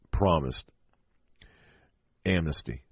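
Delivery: ordinary talk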